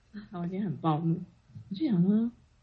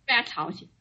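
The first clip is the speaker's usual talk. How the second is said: ordinary delivery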